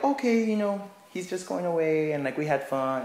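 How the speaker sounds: high, lightly voiced child; kids accepting. Kids accepting Simon leaving, lightly high child voice. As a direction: shrug it off like a content kid. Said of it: "they're all like".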